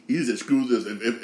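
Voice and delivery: ordinary talk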